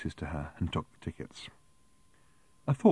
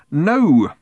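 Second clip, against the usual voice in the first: loud, raised, sudden refusal; near yell; 8 semitones higher, 10 dB louder than usual. A narrator's reading. Arthur stopping the ticket seller, nearly yelling. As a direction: cut in with a near-shout. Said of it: "Arthur nearly yelled".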